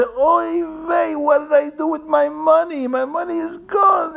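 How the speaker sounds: acted-out high wail of loss; upset wailing. A narrator's reading. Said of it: "He's gonna be so upset".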